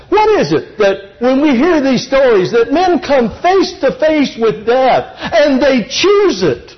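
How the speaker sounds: rising, lifted preacher's cadence; preaching. Acted. Pastor urging the congregation toward sacrifice, building fervour.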